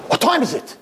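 high, urgent outburst; acted alarmed demand; sudden alarm. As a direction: blurt it out in a jolt of panic. Blind man alarmed by funeral news.

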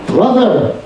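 high, piercing cry of recognition; scream. A narrator's reading. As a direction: call out her brother, pitch leaping high. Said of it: "she screamed to him".

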